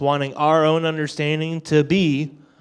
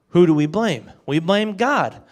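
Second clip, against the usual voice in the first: ordinary delivery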